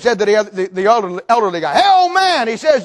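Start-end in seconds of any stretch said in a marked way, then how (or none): 1.8-2.5 high, loud taunting holler; imitated trucker hailing a stranger; calling out. Trucker to elderly man, calling out mockingly.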